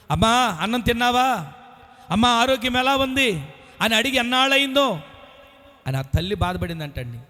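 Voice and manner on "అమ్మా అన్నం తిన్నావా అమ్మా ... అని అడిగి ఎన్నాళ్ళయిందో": mother's lament. Retold or imitated. Grieving mother's lament voiced.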